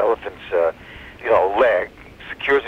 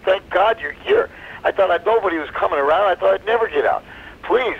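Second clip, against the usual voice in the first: higher-pitched pleading relief; acted desperate gratitude, pitched up; 8 semitones higher than usual. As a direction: plead as if rescue has just arrived. Trapped monkey greets his rescuer.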